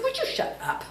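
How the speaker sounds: high, soft, teasing shush; mock shut-up. Wife teasingly hushing her husband, high and soft. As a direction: toss it off high and playful as a fond mock rebuke. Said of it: "And I said".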